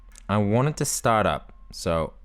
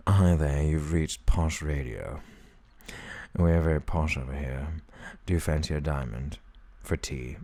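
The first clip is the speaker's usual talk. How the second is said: posh radio host; 5 semitones lower than usual. Pretend. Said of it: "I sound like a posh person".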